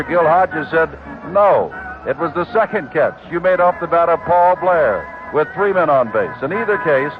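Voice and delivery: ordinary talk, nothing marked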